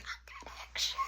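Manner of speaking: stage-whispered insistence; hushed whisper. Retold or imitated. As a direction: whisper it as a secret boast. Repeat, hushed and stretched.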